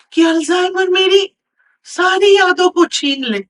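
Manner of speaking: high, urgent theatrical lament; impassioned plea. Acted. Patient dreading loss of all memories, pleading high. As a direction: lift the pitch and plead.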